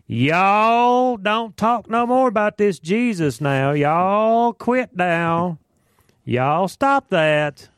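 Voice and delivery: wag a finger and scold slowly; finger-wagging elders. Retold or imitated. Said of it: "I can just see them wagging their fingers".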